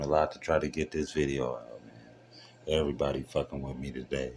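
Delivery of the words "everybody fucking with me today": ordinary delivery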